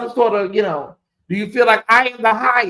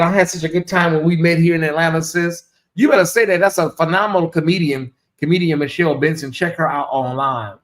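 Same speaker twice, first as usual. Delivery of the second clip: ordinary talk, nothing marked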